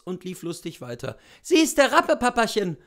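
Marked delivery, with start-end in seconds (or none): 1.4-2.8 put-on high, loud child's voice; little boy calling out. A narrator's reading. Little boy calling to his papa, high and loud. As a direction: call it out like an excited little boy. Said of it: "rief der Knabe".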